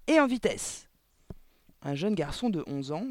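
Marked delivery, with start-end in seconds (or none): 0.1-0.9 loud, high, impatient command; bossy headmistress yell; shouted order. A narrator's reading. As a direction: shout it to hurry the child up. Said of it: "cria Le Gourdin".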